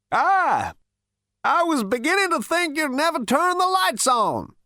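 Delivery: put-on very high, complaining old-man voice; cantankerous old great-grandfather. Ancient ghost greets the lights, high and cantankerous. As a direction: complain in a high-pitched elderly voice.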